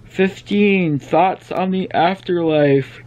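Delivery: slow, yawning through the words; stretched out by a yawn. Real, not acted. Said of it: "stop yawning".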